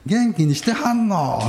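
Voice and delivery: high, drawn-out friendly hail; imitated old woman's greeting. Old woman hails him by the wrong name again, imitated high sing-out greeting. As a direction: call out like a chatty old lady, stretching the words. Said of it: "みたいな感じで".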